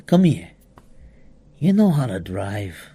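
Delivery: put-on a lightly done New York wiseguy, quietly sizing up the kid; gentle New York gangster murmur; New York mobster accent. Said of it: "He had that accent".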